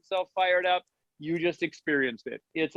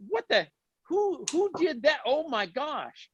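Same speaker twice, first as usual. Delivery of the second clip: retold amazement; 8 semitones higher than usual. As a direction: act out being stunned, voice swooping up. Re-enacting own amazed reaction.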